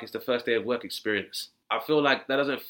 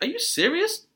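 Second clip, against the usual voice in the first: mock disbelief; 11 semitones higher than usual. Imagined disbelief at news.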